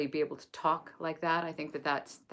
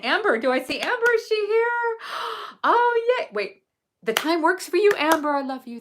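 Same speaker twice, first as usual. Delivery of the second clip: much higher and louder, gasping with joy; overjoyed squeal; 13 semitones higher, 9 dB louder than usual. Real, not acted. Teacher seeing her friend Amber arrive in chat, joyful squeals. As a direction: squeal a friend's name in delight.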